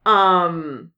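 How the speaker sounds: ordinary delivery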